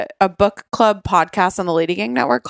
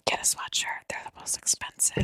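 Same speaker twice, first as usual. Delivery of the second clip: a quick hushed aside; whispering; 14 dB quieter than usual. Real, not acted. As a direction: whisper it behind a hand. Whispered aside about merch.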